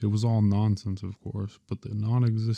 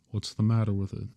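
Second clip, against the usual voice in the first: usual speaking voice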